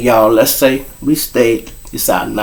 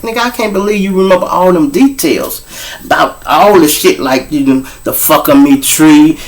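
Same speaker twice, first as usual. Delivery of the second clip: imitating his lady friend; 5 semitones higher, 4 dB louder than usual. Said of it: "so she like".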